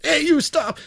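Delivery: high, barked "hey, you stop"; lawman shout. Retold or imitated. Lawmen spotting the looter, mock high shout. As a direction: call out like a lawman. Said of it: "they're like".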